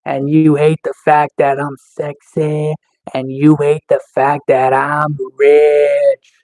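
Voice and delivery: slow, drawn-out put-down; deliberate, dragged-out gloating; slow taunting. Real, not acted. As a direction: stretch out each insult with relish.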